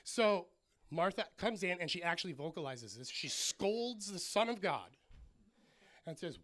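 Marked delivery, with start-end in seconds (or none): none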